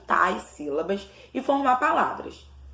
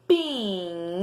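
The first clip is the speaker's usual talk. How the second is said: "Pinheiro" with every syllable stretched long; slow syllables; 2 semitones lower, slower than usual. Shown as a demonstration. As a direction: draw out PI-NHEI-RO deliberately. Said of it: "as sílabas pausadamente".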